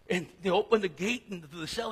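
normal talking voice

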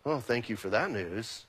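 deadpan thanks through gritted teeth; sarcastic thanks. Retold or imitated. Job answering the bearers of terrible news, dry and sarcastic.

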